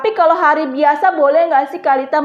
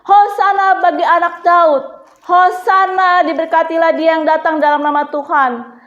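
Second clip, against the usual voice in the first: loud, raised acclaiming cry; crowd shouting; 3 semitones higher, 5 dB louder than usual. Acted. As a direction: call out as a whole welcoming crowd. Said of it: "mereka berteriak semua gini".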